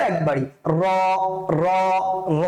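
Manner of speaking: র said heavy and thick, once; a single thick র. Shown as a demonstration. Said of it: "মোটা করে পড়বো".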